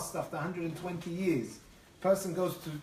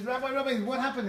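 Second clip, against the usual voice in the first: high, loud, urgent retold cry; alarmed; 8 semitones higher, 5 dB louder than usual. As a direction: call out in alarm as the startled farmer. Farmer bursts in on crying rabbi.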